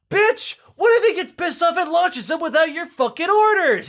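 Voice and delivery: high-pitched, loud comeback; acted angry retort. Kremlin official shouting down Khrushchev's excuse, acted angry yell. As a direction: shout him down, high and heated.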